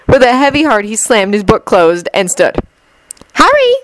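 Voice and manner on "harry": high-pitched call of a name. A narrator's reading. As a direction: call after a friend in a high girl's voice. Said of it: "Ginny called".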